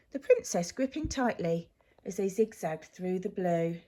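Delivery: ordinary delivery, nothing marked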